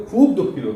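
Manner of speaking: "খুব" উঁচু সুরে জোর দিয়ে; খুব শব্দটায় ধাক্কা দাও; stress on খুব. Shown as a demonstration. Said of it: "উপরে আরও জোর দেয়". (খুব শব্দে জোরালো চাপ)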